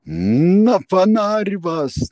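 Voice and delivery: very high, loud, slow drawn-out scream; shrieking lynch cry; wild yell. A narrator's reading. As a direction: howl the threat in bloodlust. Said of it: "дико вопил младший адъютор".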